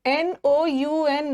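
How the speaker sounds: slow letter-by-letter spelling of p r o n o u n; teacher spelling a word aloud, slowly. Shown as a demonstration. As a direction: spell each letter slowly and clearly for students. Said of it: "spelling देख लेना".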